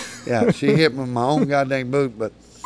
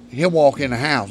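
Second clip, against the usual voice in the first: usual speaking voice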